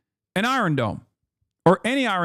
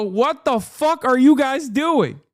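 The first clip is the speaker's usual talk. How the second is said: high, slow, louder disbelieving cry; exasperated outburst; 8 semitones higher, 3 dB louder, slower than usual. Retold or imitated. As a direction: demand an answer, outraged. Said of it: "I look at this and I go".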